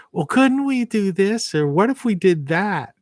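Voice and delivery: high, sing-song mockery of naive kids; mock their eager suggestions up high. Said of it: "But it's like".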